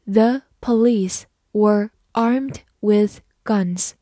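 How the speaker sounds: slow, careful read of The police were armed with guns. Shown as a demonstration. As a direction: read slowly word by word. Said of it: "好，慢慢来读。". (慢慢地朗读)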